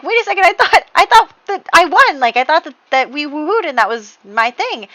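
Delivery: high, loud, flustered disbelief; bewildered disbelief. Acted. Francesca to Jay, baffled he chose her sister, high flustered disbelief. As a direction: sputter like the rug was pulled out.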